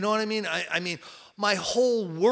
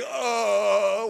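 mock terrified groan; 4 semitones higher than usual. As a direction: gasp at the thing on the ceiling. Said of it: "like this".

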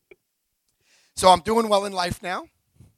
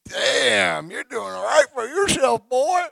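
imitating a Southern drunk, pitch climbing higher toward boy; a Southern drunk, impressed and half laughing; Southern drawl; 6 semitones higher, slower than usual. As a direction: play the impressed Southern drunk in the back seat. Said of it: "That's the way they talk down there".

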